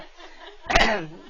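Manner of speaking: coughing through a laugh. Real, not acted. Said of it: "I just swallowed a bug".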